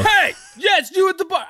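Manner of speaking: high, hollered call to a customer; playfully single someone out across the bar; mock bartender yell. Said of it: "Oh, you're yelling".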